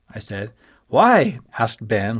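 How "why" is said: high, loud "Why?"; surprised and disappointed. A narrator's reading. Friend hearing he dropped the class, surprised and disappointed. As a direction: let dismay push it up high. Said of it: "looking surprised and disappointed".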